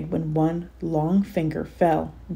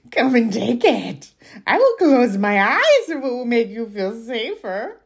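troll voice; 6 semitones higher, 4 dB louder than usual. Acted. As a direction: bargain like a crafty troll. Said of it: "Your troll voice is on point".

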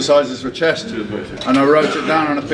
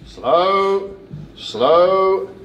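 coach shouting cues; 7 semitones higher, much slower than usual. Real, not acted. Coach cueing a lift.

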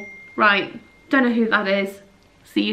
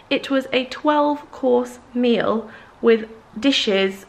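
twelve drawn out slowly and stressed; slow, amazed emphasis on twelve; 2 semitones higher, much slower than usual. Real, not acted. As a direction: linger on twelve for effect. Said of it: "emphasis on twelve".

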